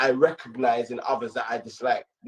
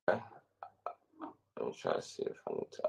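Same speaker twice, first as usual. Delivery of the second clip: muttering to herself; 13 semitones lower, 13 dB quieter, slower than usual. Real, not acted. Muttering while fixing the call.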